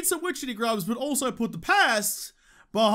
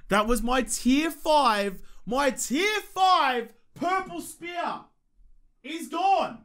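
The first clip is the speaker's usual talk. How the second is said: angry outburst; 4 semitones higher, much slower than usual. Real, not acted. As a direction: drag out every word in fury. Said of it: "I'm angry, I'm mad".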